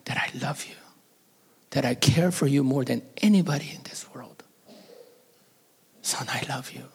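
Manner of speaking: hushed, unvoiced pleading; father begging his son's forgiveness in a whisper; tender whisper. Father whispering apology to son.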